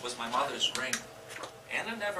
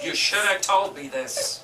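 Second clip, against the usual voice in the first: hurt reproach; 5 semitones higher, 8 dB louder than usual. Acted.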